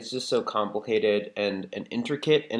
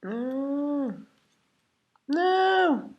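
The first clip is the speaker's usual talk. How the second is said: high-pitched dismay; 5 dB louder than usual. Real, not acted. Part slipping out of place.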